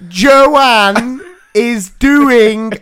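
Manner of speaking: exaggerated slow speech. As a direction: spell it out slowly as if for lip reading. Mimicking over-clear talk to deaf dad.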